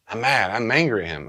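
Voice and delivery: voicing an angry mourner; acted anger. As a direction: play the angry griever. Acted angry griever in dialogue.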